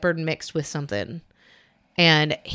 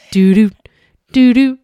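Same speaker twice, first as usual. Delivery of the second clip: panther creeping tune; 4 semitones higher, 9 dB louder than usual. Retold or imitated. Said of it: "walking like a panther".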